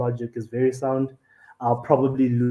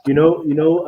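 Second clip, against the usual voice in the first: ordinary talk, nothing marked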